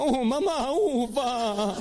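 put-on high whining child, pleading; a boy whining at his mother; whiny kid. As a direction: whimper like a scared kid. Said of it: "y decías".

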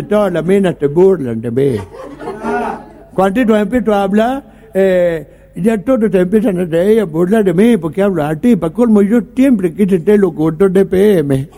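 lightly voiced lisp, s drifting toward t; a mild version of Miguelito's speech defect; a hint of a speech defect. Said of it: "tenía un problema de dicción".

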